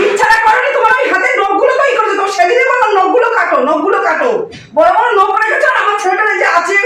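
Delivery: high, loud scolding of the bride; angry scolding. Acted. Mother-in-law scolds her daughter-in-law over the pinch, loud angry scolding. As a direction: tear into her for hurting your son.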